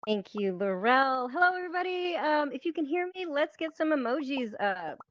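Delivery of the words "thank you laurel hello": ordinary talk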